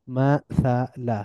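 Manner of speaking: মাসালা slowly as ma, tha, la; syllable by syllable. Shown as a demonstration. Teacher breaking down the word, মাসালা syllable by syllable. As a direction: split মাসালা into three drawn-out syllables. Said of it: "একটু মিলিয়ে মিলিয়ে পড়ব".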